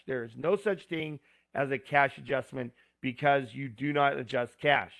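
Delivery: ordinary talk, nothing marked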